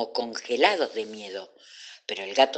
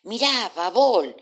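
high, drawn-out wonder; astonished; 9 semitones higher, 5 dB louder than usual. A narrator's reading. Dolores pointing something out to Babol, astonished. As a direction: call out amazed, pitch leaping up. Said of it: "dijo Dolores asombrada".

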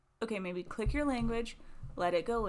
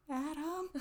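retold call; 8 semitones higher than usual. Calling Adam again, helpless.

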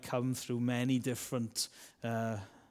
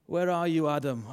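God calling loudly for Adam; 3 semitones higher, 6 dB louder than usual. Acted. God calling Adam in the garden, loud call.

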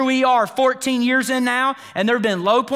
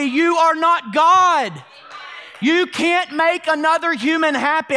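shouted declaration; 7 semitones higher, slower than usual. Real, not acted. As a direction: hammer it home. Preacher shouting point.

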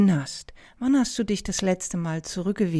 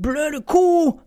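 imitated driver's yell, high and much louder; shouted insult; 8 semitones higher, 9 dB louder than usual. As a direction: yell it out like an angry passing driver. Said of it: "rief der".

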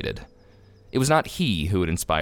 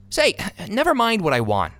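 indignant, much higher voice; angry retort; 10 semitones higher than usual. A narrator's reading. Benjamin snapping back at the tailor's clerk, angry. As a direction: retort hotly, pitched way up. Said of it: "he retorted, angrily".

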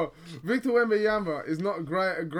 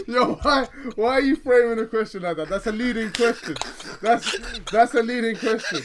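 laughing; 3 semitones higher, 4 dB louder than usual. Real, not acted. Laughing at teasing question.

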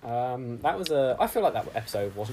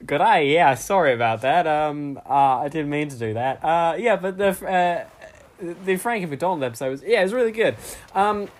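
louder, overdone bad Australian accent; Mister Aussie; 3 semitones higher, 5 dB louder than usual. Mister Aussie apologising for slipping American, hammed-up Australian accent. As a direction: lean hard back into fake Aussie. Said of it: "bad impression of an Australian person called Mister Aussie".

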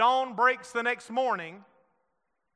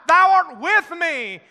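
proclaimed quote; 8 semitones higher, 10 dB louder, slower than usual. Acted. Quoting the psalm emphatically.

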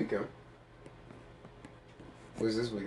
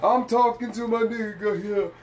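[name] impression; 11 semitones higher, 4 dB louder, slower than usual. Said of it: "That's a terrible [name]".

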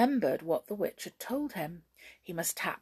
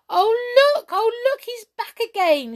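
much higher, loud delighted cry; excited shout; 12 dB louder than usual. A narrator's reading. Fairies shouting in delight at the trick, excited. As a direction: cheer in wonder. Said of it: "shouted the fairies and brownies".

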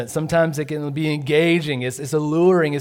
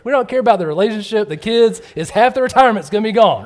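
louder, higher mock-exasperated outburst; 8 semitones higher, 8 dB louder than usual. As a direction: throw up your hands at their priorities. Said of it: "I'm like".

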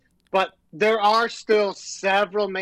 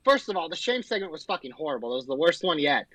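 ordinary talk, nothing marked

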